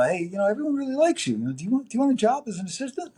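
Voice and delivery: quick high offer from the studio manager. Retold or imitated. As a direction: imitate Joyce breezily offering the job. Joyce offers him a job.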